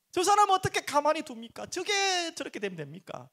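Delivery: cursing lament. Retold or imitated. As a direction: wail an angry complaint to God. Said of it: "하면 막 저주까지 퍼부어요".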